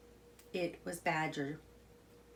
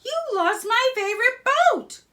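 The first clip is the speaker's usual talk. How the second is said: high, loud, scolding complaint; angry Rat; 12 dB louder than usual. A narrator's reading. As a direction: tell him off for losing the boat. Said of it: "Rat said angrily".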